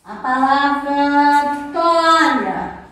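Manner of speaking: "toalha" drawn out slow and loud; stretched-out teaching pronunciation; slow careful word. Shown as a demonstration. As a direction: say "toalha" very slowly for the class. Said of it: "É muito importante que você pronuncie".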